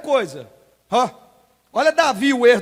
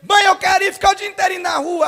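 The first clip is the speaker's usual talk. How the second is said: put-on whiny child; 6 semitones higher than usual. Child asks mother, voiced.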